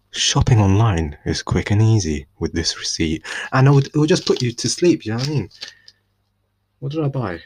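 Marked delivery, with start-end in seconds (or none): 0.1-3.2 slow, close-mic reading of a receipt; sleepy audiobook reading. Shown as a demonstration. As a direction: lull them to sleep with a shopping receipt. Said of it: "And they'll sound like this".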